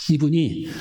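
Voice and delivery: normal talking voice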